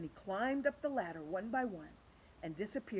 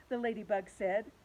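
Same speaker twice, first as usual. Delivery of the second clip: usual speaking voice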